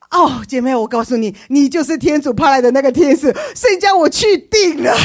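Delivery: loud, high and triumphant; overjoyed. Retold or imitated. Telling the caller she is God's angel, overjoyed and loud. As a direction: proclaim it like great news.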